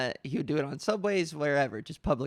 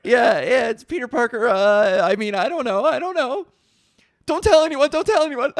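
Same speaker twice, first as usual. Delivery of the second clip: loud, high, laughing backpedal; hammed-up frantic plea for secrecy; mock-panicked cover-up; 6 semitones higher, 9 dB louder than usual.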